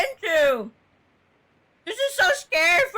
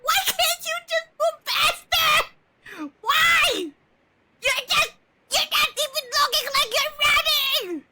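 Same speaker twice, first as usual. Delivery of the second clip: high, tearful shouting, half laughing; retold panic, shrieked and laughing; mock panicked yell; 10 semitones higher than usual. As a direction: replay the frantic scream at the screen. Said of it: "I'm just like".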